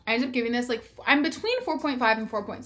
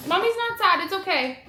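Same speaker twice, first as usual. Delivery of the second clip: higher, gentle comfort for the little one; 8 semitones higher, 3 dB louder than usual. Real, not acted. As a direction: soothe her daughter, Mommy is fine. Reassuring her child Lila.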